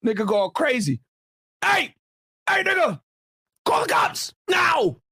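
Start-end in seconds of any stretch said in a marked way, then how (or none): 1.6-5.0 high, shouted alarm; hammed-up clerk yelling for the cops; a panicked shopkeeper yells to call police; mock frantic yell.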